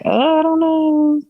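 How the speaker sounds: slow, high, hesitant mock voice; unsure whine. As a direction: drawl it out, unsure and anxious. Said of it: "still kind of feeling like".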